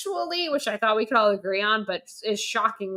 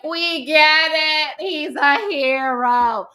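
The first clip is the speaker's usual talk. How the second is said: slow, loud, higher fed-up drawl; exasperated; 6 semitones higher, 6 dB louder, much slower than usual. Retold or imitated. A critic mocking the repeated hero theme, slow and loud. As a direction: drag it out like you're sick of hearing it. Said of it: "and I'm like".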